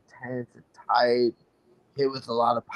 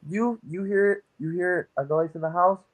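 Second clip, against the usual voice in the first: acted worry; 6 semitones higher than usual. Acting out a worried listener.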